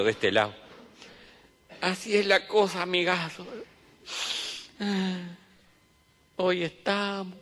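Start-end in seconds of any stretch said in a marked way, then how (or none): 1.8-7.3 mock solemn mourner. Mourner philosophizes to his companion.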